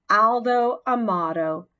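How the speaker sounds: plain reading voice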